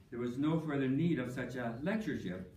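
ordinary talk

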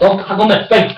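loud, high alarmed shout; panicked game call-out; urgent alarm. Real, not acted. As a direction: shout a warning to run. Alarm as enemy approaches.

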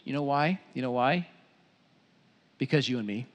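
ordinary talk, nothing marked

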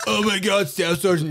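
high, laughing squeak of a scared recruit; squeaky scared recruit. Retold or imitated. Terrified recruit to staff sergeant, high laughing squeak. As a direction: play the terrified young recruit, voice jumping up high.

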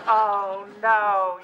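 ordinary talk, nothing marked

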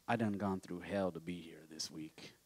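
a hushed whispered aside; whispered confession. Real, not acted. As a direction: drop to a whisper to let them in on it. Confessing hard week to congregation.

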